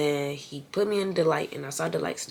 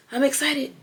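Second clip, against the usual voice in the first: higher, louder burst of excitement; excited; 10 semitones higher, 4 dB louder than usual. Real, not acted. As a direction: let the excitement jump out.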